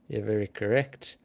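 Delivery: ordinary talk, nothing marked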